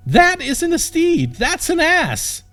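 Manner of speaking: very high, slow outraged cry; outraged at the ass; indignant cry. Acted. Princess to knight about his donkey, very high outraged cry. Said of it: "she cried out".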